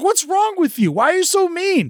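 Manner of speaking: high, indignant questions; retold angry retort. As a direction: throw it at the rude lady. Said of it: "I was like".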